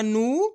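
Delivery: नू drawn out, gliding upward; long vowel. Shown as a demonstration. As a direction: hold the oo of नू and let it rise. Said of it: "खींच खींच के इसका उच्चारण".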